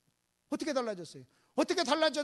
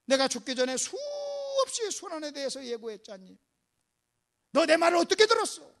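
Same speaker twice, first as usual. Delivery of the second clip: exasperated scolding building to loud, repeated insistence; staged master reproaching his pupils; acted rebuke; 6 semitones higher, 5 dB louder than usual. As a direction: hammer the reminder, then ease off. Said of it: "이렇게 책망할 수도 있었어요".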